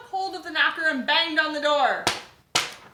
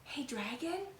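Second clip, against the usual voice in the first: soft whisper; 3 semitones higher, 10 dB quieter than usual. Acted. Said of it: "whispered very softly".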